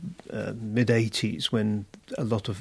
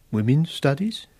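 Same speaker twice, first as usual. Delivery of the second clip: women's studies echoed with a doubtful rise on studies; frowning echo; 4 semitones higher than usual. Acted. Wilcox echoes Robin's field back, doubtful and frowning. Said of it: "Wilcox echoed with a frown".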